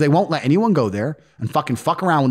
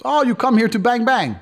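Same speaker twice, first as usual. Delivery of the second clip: raised Eastern European accent, mocking broken English; mock Moldovan accent; 8 semitones higher than usual. Moldovan man jeering at the Americans and their girls, mock accent. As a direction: play the local guy jeering at the tourists. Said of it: "He goes".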